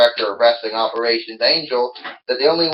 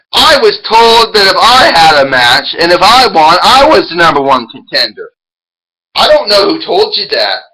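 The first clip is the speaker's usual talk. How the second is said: heated argument; 3 semitones higher, 6 dB louder than usual. Pretend. Heated argument between wrestlers.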